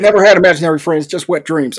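mock-presidential folksy tone; George W. Bush impression. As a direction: do Bush delivering the punchline. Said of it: "I'm doing it as George W. Bush".

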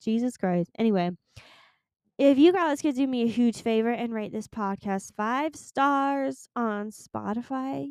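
ordinary talk

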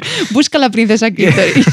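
talking through laughter; cracking up at your own joke; real laughter. Real, not acted. (hablando muerta de risa)

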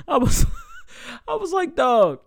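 high, loud words through laughter; laughing. Real, not acted. Teller recalling a sports argument in the dorm, laughing. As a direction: crack up while telling it. Cracking up over his own story.

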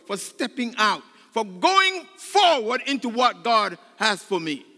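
high, loud, pleading preacher cry; full-force preaching. Acted. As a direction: preach it, climbing with every line.

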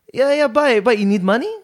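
very high, badly faked Lolo accent; bad grandpa accent. Grandson retells Lolo offering money, bad grandpa accent. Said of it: "that was a bad accent".

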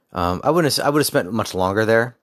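ordinary talk, nothing marked

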